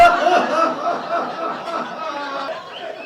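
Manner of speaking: loud laugh rolling over the crowd; big laugh. Real, not acted. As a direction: cackle at her own joke. Laughs at own joke.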